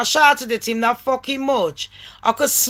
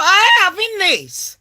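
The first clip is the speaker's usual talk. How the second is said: retold indignation; 8 semitones higher than usual. Retells indignant resolve.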